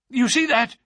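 high, loud, urgent question; nervous resident patient. A narrator's reading. Nervous patient shows detective his box, high urgent voice. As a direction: point it out insistently.

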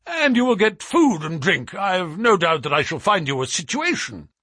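high, loud promise for others to hear. A narrator's reading. Trevor promising the sailor food and work, out loud. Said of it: "he continued out loud".

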